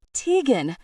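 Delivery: high, loud desperate roar; roaring her name. Acted. Noah outside the bar shouting for Tegan, loud. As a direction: yell it at the top of your lungs.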